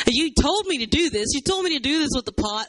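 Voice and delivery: high, insistent protest; venting complaint to God. Acted. Jeremiah alone letting God have it, aggrieved protest. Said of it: "just let him have it".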